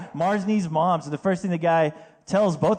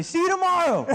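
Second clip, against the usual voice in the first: very high, hollered farewell; mock send-off yell; 12 semitones higher than usual. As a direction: call it after them, hammed up. Acted Wendy's worker yelling after customer.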